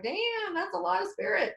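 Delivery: high awed disbelief; awed surprise. Retold or imitated. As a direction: gasp it out, impressed. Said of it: "even I was like".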